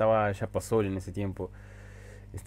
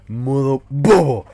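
slow, loud and silly; goofy drawn-out shout; 4 semitones higher, 14 dB louder, slower than usual. Acted. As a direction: stretch it out like a goof. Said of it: "Y estoy modo bobo hoy".

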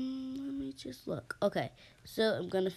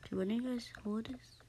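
whispering; 3 semitones higher, 6 dB quieter than usual. Real, not acted. Whispering while playing.

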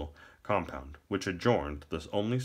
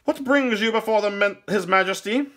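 very high, loud courtly question; higher-pitched minstrel; 9 dB louder than usual. A narrator's reading. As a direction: challenge the visitor in a high voice. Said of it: "softer, higher-pitched tone".

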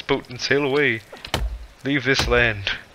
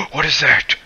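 startled gasp, then high, loud question; 7 semitones higher, 6 dB louder than usual. Real, not acted. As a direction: gasp and blurt it out in alarm. Startled by something in game.

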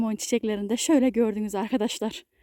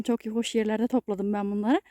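ordinary delivery

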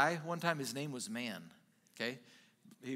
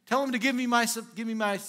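louder, higher, insistent demand; insistent mock demand; demanding brother; 6 semitones higher, 7 dB louder, faster than usual. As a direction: act out a pushy heir demanding his share. Acting out the brother demanding inheritance.

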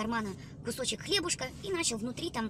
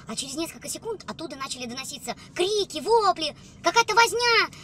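normal talking voice

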